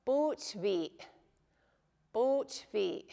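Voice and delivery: "bootvi" cut off by the throat at the end; final glottal catch. Shown as a demonstration. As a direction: finish "bootvi" on a little throat catch, carefully. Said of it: "that little catch right at the end".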